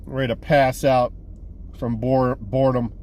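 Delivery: low, drained wind-down; tired. Real, not acted. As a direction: sound worn out and ready for bed. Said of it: "I'm running out of steam here".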